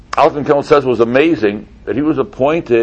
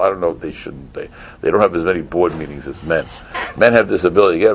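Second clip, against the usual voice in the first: ordinary talk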